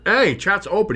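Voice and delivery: ordinary talk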